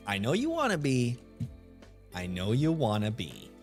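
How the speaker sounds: ordinary delivery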